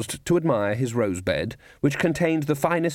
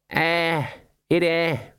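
higher, words stuck round the tongue; tongue-tangled; 6 semitones higher, much slower than usual. A narrator's reading. Sergeant prodding his mouth with his tongue, tangled speech. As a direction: talk while prodding your back teeth. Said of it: "and untangled himself".

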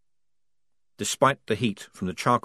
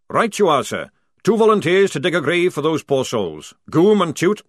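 loud, higher barking sergeant; bellowing sergeant; 8 semitones higher, 7 dB louder than usual. A narrator's reading. Jackram ordering the squad, loud sergeant bark.

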